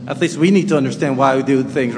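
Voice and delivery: ordinary talk, nothing marked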